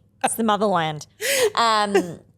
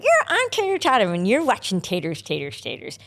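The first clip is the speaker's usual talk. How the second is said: Tater Tottem; 3 semitones higher than usual. Acted. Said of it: "I did one of Tater Tottem".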